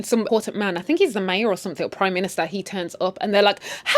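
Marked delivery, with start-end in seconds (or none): 3.9-4.0 high, loud hammed-up hey; sudden bright greeting. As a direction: switch to fake delight instantly. Couple greeting the prime minister.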